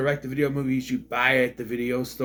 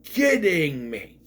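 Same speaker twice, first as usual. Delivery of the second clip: exasperated disbelief; 5 semitones higher, 4 dB louder, slower than usual. Retold or imitated. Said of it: "I lost it".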